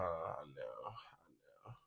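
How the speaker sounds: whispered sympathy to the tired pile; soft whisper. Real, not acted. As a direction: whisper "I know, I know" softly. Consoling the pile, hushed.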